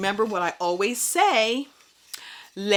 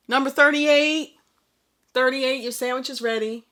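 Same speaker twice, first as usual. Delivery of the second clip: higher, louder counter clerk calling out a number; deli counter call; 7 semitones higher, 5 dB louder than usual. Retold or imitated. Doctor imitated as a deli clerk calling orders, high and loud. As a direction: sing out the order like a sandwich counter. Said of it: "Just as if, like".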